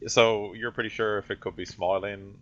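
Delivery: normal talking voice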